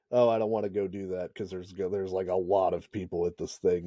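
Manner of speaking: reluctant grumble. Retold or imitated. Man voicing his dread of a big crowd, reluctant. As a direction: say it low and quiet, dragging your heels.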